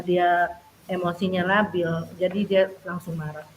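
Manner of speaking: ordinary delivery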